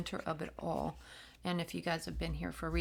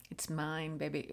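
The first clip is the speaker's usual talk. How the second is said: usual speaking voice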